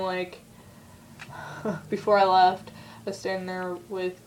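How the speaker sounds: ordinary talk, nothing marked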